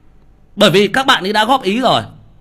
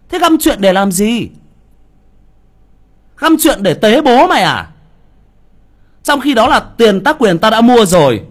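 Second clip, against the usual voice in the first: louder, hammering each point; angry rant; 5 dB louder than usual. Real, not acted. Streamer to the commenter who accused him, angry and loud. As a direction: push back hard at the accusation.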